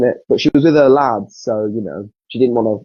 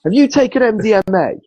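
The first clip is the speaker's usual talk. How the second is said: higher put-on voice, half laughing; 9 semitones higher than usual. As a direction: ask it like a suspicious rock star. Retold [name] voice.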